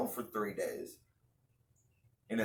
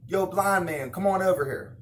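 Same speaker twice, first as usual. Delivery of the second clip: loud, higher call across the crowd; 7 semitones higher, 6 dB louder than usual. Pretend. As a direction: summon the blind man over. Playing Jesus calling blind man.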